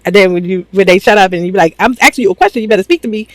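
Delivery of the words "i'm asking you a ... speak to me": mom scolding. Retold or imitated. A mom scolding her kids, mock stern. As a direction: demand an answer like a fed-up mom.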